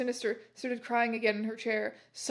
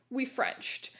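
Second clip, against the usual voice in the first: ordinary delivery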